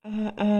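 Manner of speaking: weak groans. Acted. Father waking weak in hospital.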